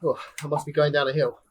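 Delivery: ordinary talk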